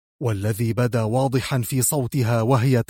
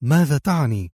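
bewildered short question; perplexed, wanting an explanation; puzzled. A narrator's reading. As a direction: ask in mild confusion. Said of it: "تساءلت في حيرة".

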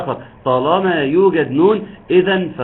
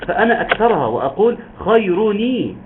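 ordinary delivery

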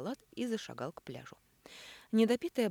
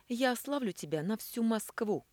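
threatening; 7 semitones higher, 5 dB louder than usual. A narrator's reading. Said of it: "пригрозила Элина".

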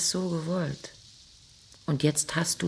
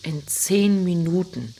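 slow and louder, every word weighed; taunting emphasis; 2 semitones higher, 7 dB louder than usual. Acted. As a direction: drag it out to make it hurt. Wife taunts husband. (langsam und laut betont, verhöhnend)

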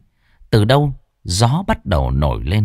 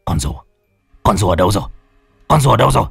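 thảng thốt; much faster than usual. Acted. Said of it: "lão hét lên một tiếng thảng thốt".

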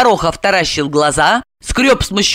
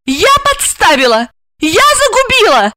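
very high, outraged echo; indignant outburst; 4 dB louder than usual. A narrator's reading. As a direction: fling his accusations back, pitched high. She throws accusations back indignantly. (возмущённо, высоко, на повышенных тонах)

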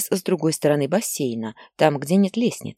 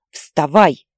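much louder command; furious shout; 9 dB louder than usual. Acted. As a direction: yank him out of bed with the word. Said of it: "кричала Лариса". (мать громко кричит сыну, чтобы вставал)

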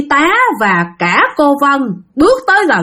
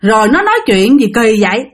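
ordinary delivery, nothing marked

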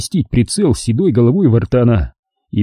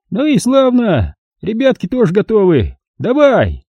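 put-on high, gloating old man voice; a cruel old miner mocking his victim; old prospector; 10 semitones higher than usual. A narrator's reading. Old man gives the signal.

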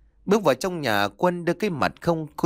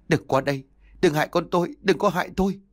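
terrified; 7 semitones higher, faster than usual. A narrator's reading. Terrified wife pushing husband away.

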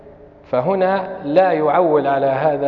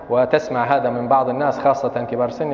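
ordinary delivery

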